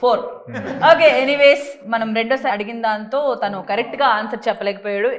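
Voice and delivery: ordinary talk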